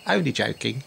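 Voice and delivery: normal talking voice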